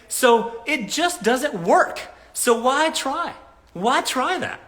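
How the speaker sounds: can't believe anyone thinks this; incredulous rant. Real, not acted. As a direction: throw up your hands in disbelief.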